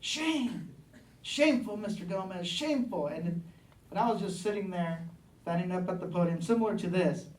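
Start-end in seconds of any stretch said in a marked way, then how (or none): 0.0-3.1 high-pitched mock yell without much volume; retold yelling. Speaker recounting a heckler at a committee hearing, retold yelling. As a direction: imitate a heckler's cry, pitched up but held back. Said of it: "just starts yelling".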